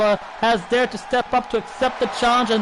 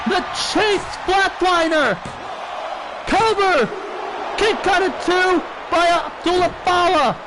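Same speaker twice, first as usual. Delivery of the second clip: wrestling commentator; 4 semitones higher than usual. Real, not acted. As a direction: shout the big moves as they land. Live wrestling play-by-play climax.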